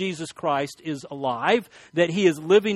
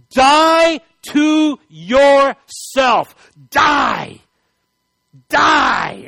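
slow, high shouted command to die; emphatic command; 7 semitones higher, 10 dB louder, much slower than usual. Shown as a demonstration. Preacher to congregation, loud commanding shout. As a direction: thunder the command, drawing it out. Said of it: "this emphatic, intensive way".

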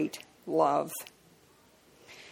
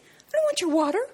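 high, snippy mock refusal; hammed-up sneering "no thanks"; nasty refusal; 11 semitones higher than usual. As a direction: mimic a rude stranger turning down water. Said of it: "the people who get really nasty".

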